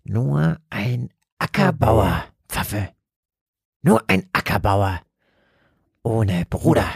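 slow, eerie demonic hiss. Acted. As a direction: hiss it out slowly, sinister.

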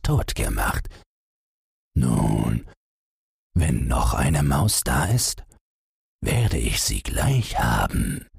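a hint of a sly hungry cat. A narrator's reading. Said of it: "Da sprach die Katze".

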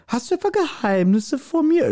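mock jealous wife asking; wife questioning husband in hammed-up falsetto; shrill suspicious wife. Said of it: "Weil die Frau kommt dazu".

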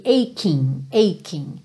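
"Aching" said "EI-king", k sound; aching drill. Shown as a demonstration. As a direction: repeat "aching" with a k. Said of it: "som correspondente ao Q U". (repetição de "aching" com k)

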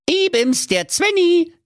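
very high little dwarf voice; cheeky ghost dwarf. Acted. Dwarf ghost introduces himself to the miser, cheeky. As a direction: pipe up as a tiny ghost.

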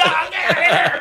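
a laughing sendup of thrilled fans; mock excited fans. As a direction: imitate fans losing it, lightly. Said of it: "and they're like".

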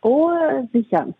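ordinary talk

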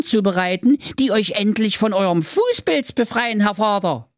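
high, proud boasting son. Acted. Son proudly boasting to father, high voice. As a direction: brag like a quack's apprentice. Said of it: "antwortete stolz".

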